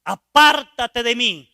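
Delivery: loud, slow apártate de mí; a loud pushing-away cry; repeated rejection. Retold or imitated. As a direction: shout it again, dragging apártate.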